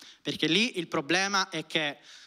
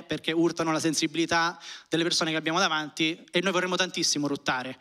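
ordinary talk, nothing marked

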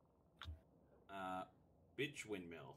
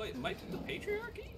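sarcastic high question; 4 dB quieter than usual. Pretend. Joking question.

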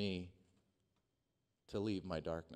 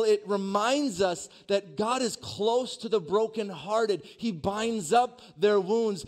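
impassioned preacher, rising higher and louder; preaching, building to a shout; 9 semitones higher, 9 dB louder than usual. Real, not acted. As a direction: build the proclamation to a ringing climax. Preaches at full force, building.

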